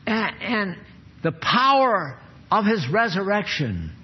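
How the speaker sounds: ordinary talk, nothing marked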